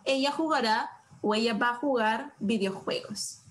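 usual speaking voice

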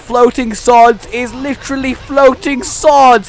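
loud, high, stretched-out awe; gleeful outburst, hitting each phrase hard; thrilled exclamation. Real, not acted. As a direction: shout it out in real delight. Thrilled by floating swords in game.

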